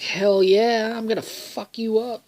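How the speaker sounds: high-pitched, hyped-up taunt; mock fighting boast. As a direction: imitate a pumped-up fighter. Retold fighter taunting opponents.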